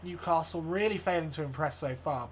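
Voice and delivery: usual speaking voice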